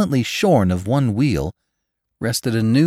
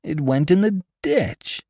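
high, slow puzzled explanation; bewildered, drawn out at a raised pitch; 10 semitones higher than usual. A narrator's reading. Puzzled wreck survivor explains to onlookers.

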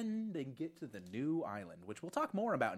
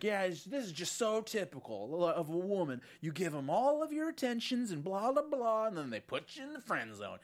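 whiny incel quote; 6 semitones higher than usual. Retold or imitated. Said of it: "And he's like".

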